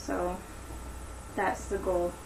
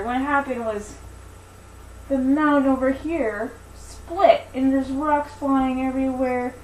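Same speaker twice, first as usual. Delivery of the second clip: animated storytelling, higher than chat; story narration; 6 semitones higher, 7 dB louder, slower than usual. Acted. Teacher telling Daisy and the giant to children, animated story narration. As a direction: tell it with slow, drawn-out key words.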